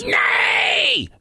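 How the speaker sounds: very high, drawn-out pirate yelp; alarmed pirate. Acted. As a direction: squeak out a denial to the giant. Pete answers the giant.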